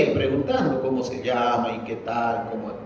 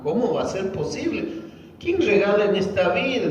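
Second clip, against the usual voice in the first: higher-pitched retold disbelief; incredulidad; 7 semitones higher than usual. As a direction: question it as an incredulous child would. Retells child doubting the gift. (incredulidad imitada)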